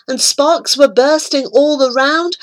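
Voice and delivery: plain reading voice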